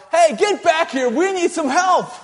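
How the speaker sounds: high, loud yell after a boat that is moving away; calling the deacons back, loud and high. Retold or imitated. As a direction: shout for help across the lake. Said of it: "And I yell".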